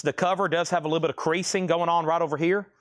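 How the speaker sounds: usual speaking voice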